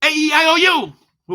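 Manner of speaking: high, loud vowels; loud, high, jolting vowels; loud and fast. Shown as a demonstration. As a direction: blast the vowels out. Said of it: "Loud and fast".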